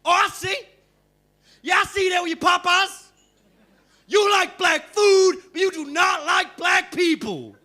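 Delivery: hustler's sudden furious accusation; angry shout. Retold or imitated. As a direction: turn on him in sudden outrage. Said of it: "kindness just turned to sheer, unadulterated anger".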